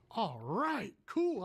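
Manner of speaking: eager, rising to a high "cool"; excited agreement. Retold or imitated. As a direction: jump at the offer with enthusiasm. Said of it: "I got excited".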